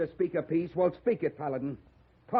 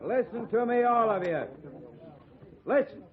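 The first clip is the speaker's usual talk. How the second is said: commanding; 7 semitones higher than usual. Acted. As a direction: raise your voice over the crowd. Rancher lays down the law.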